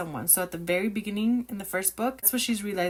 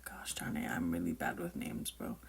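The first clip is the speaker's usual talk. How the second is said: soft unvoiced self-scolding whisper; frustrated whisper; 12 dB quieter than usual. Real, not acted. Whispering to herself, annoyed at forgetting a name.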